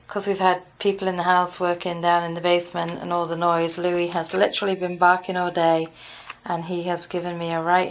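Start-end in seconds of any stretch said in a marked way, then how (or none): none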